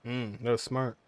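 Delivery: ordinary delivery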